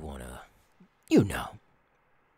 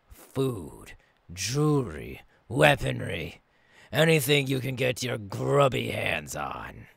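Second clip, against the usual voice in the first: usual speaking voice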